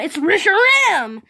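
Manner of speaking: ordinary talk, nothing marked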